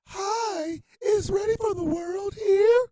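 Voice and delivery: put-on high, light girlish voice. Fan girl at the door asking for the band, high girly voice. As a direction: voice a young woman at the door, sweetly. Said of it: "And she goes".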